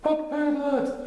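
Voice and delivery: high, slow questioning bear; puzzled little bear; slow Pooh. A narrator's reading. Pooh's voice.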